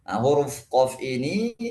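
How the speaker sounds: ordinary delivery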